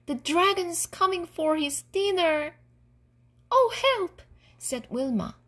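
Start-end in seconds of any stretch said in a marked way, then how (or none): 0.1-2.6 high-pitched gnome warning; alarmed squeak. A narrator's reading. Trembling gnome warns that the dragon is coming, squeaking. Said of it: "he squeaked". 3.5-4.2 high, loud yelp for help; alarmed cry. A narrator's reading. Wilma hears the dragon is coming, cries out alarmed.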